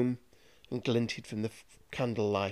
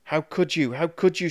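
higher, louder betrayed cry, rising on the repeat; anguished scream; 5 semitones higher, 5 dB louder than usual. Acted. Master crushed by his apprentice's spell, anguished scream. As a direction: shout it out in pain and betrayal. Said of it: "screamed Carrigan".

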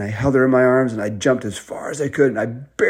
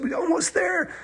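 very high, strained cry; acted breathless-child excitement, pitched way up; urgent encouragement; 3 dB quieter than usual. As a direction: urge them on in a high squeal. Urging on across swift river.

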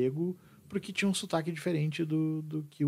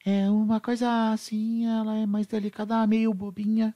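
traditional Japanese woman; 7 semitones higher than usual. Shown as a demonstration. Teacher imitating a traditional Japanese woman's intonation, delicate and high-pitched. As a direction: play a dainty, slightly silly Japanese woman. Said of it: "quando tu imagina uma mulher japonesa tradicional falando".

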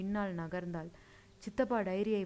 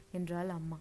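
plain reading voice

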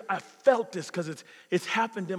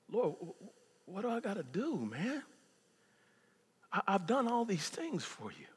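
softer, pleading Hezekiah; mild broken plea; 2 semitones higher, 7 dB quieter than usual. Acted. Hezekiah heartbroken before the Lord, soft plea.

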